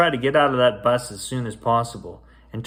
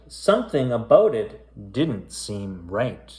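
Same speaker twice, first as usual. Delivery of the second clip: spooky rhyming storybook read; story narration; slower than usual. A narrator's reading. Reading a creepy rhyming tale to kids, storyteller voice. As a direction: read it aloud like a bedtime ghost tale. Reads a spooky rhyme aloud.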